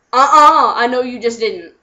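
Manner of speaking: loud sassy uh-uh. Retold or imitated. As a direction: give him attitude. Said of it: "I'm like".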